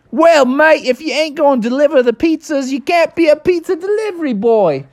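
Australian boss questioning his late driver. Acted. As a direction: scold him like an Aussie boss. Dingo Dan explains firing.

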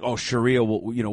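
ordinary talk, nothing marked

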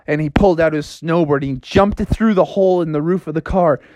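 usual speaking voice

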